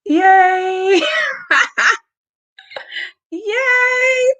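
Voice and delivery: high, happy yay cheers; excited cheer. Real, not acted. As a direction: cheer it out, thrilled. Celebrating a finished launch live.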